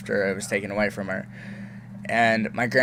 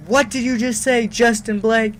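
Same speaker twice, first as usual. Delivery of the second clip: high, louder scolding mom voice; a parent catching her son swearing; mock angry mom; 11 semitones higher, 5 dB louder than usual. Mom runs in and scolds her son, high and sharp.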